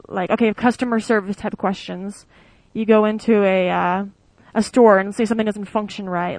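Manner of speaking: ordinary delivery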